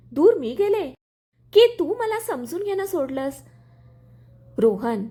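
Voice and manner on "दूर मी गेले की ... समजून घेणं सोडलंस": hurt anger, pitched up and louder; angry. A narrator's reading. Wife to husband in a quarrel, angry and raised. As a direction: throw the blame back at him in anger. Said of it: "Arya रागाने".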